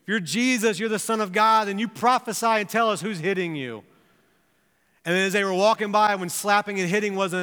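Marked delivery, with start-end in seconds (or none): 0.0-3.8 higher-pitched taunting challenge; mocking. Tormentors taunt blindfolded Jesus to prophesy, mocking. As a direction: imitate tormentors jeering at a prisoner. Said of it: "And they mocked him".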